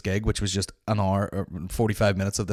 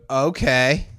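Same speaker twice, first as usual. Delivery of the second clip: louder, rising dismissive "Okay."; sarcastic okay; 4 semitones higher, 7 dB louder than usual. Retold or imitated. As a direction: brush him off with sass. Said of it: "she would go".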